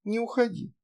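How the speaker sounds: put-on high soft little girl voice; a small child, gravely; serious little girl. A narrator's reading. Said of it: "серьёзно посмотрела и сказала".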